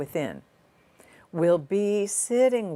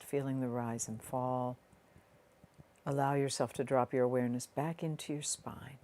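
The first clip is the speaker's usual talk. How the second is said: soft, unhurried meditation voice with long pauses; guided meditation; 2 semitones lower, 5 dB quieter than usual. Real, not acted. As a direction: ease the listener gently inward, leaving space between phrases.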